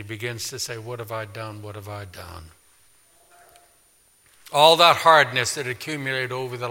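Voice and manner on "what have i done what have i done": much softer, lower anguished murmur; broken remorse. Acted. David realizing his sin, softer broken murmur. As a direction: let the guilt crush the words.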